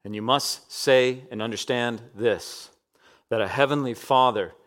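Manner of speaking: ordinary talk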